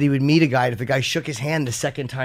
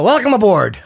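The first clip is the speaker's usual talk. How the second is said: 1950s businessman; 6 semitones higher, 7 dB louder than usual. Pretend. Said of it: "traditional, you know, nineteen fifties".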